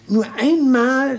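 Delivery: Nur einmal hoch und zögernd herausgehoben; dramatic high emphasis. Acted. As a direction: raise the voice high and slow down, as if a painful memory. Defender recalls a lost case. („Nur einmal" hoch und gedehnt betont)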